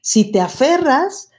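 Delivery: ordinary delivery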